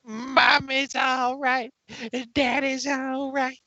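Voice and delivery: sing-song goofy voice; silly character voice. Pretend. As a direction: friends riffing in silly voices.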